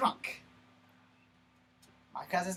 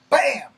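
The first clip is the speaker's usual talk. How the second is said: loud, high bam for the second hit; imitated punch; 6 semitones higher, 14 dB louder than usual. Second punch to his face, loud bam. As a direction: smack the word out like a fist.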